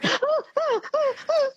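quick high-pitched gasps from icy water; high-pitched cold gasps. Retold or imitated. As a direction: imitate gasping squeals on hitting freezing water. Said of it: "my voice is still very high-pitched when I'm going".

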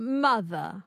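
"Mother" copied with no final R; dropped R schwa. Shown as a demonstration. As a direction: echo "Mother" ending on uh. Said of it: "That's it. That's really good".

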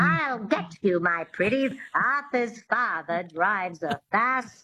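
witchy twang into a slow Mid-Atlantic accent; slow twangy Mid-Atlantic accent demo. Shown as a demonstration. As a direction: run the twang through the whole sentence. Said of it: "that twang is really important".

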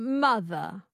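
MOTHER with a schwa for the final R; dropped R. Shown as a demonstration. Student echoing coach, mother without R. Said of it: "you drop the R and you make it a schwa".

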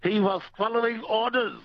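high-pitched mock German punchline; mock German accent. Caller lands his German swan joke, mock German accent.

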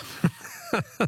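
a sudden high laugh that breaks out; burst of laughter. Real, not acted. Radio host cracks up at a caller's wild guess, real laughter.

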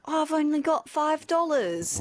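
high-pitched child voice with a put-on accent; put-on accented kid. Child short of money at the video store, put-on pleading voice. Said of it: "I don't know why I had an accent".

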